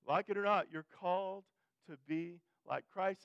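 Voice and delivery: emphatic preacher's repeat. Real, not acted. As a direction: drive the line home from the pulpit. Preacher repeats the line with force.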